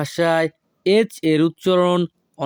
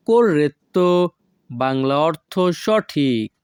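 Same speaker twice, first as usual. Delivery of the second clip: usual speaking voice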